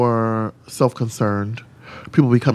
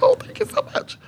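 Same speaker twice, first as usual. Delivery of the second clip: much higher, squealing thank you; flattered gush. Retold or imitated. Drag queen thanking the stylist, flattered and high.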